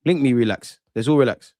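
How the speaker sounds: ordinary delivery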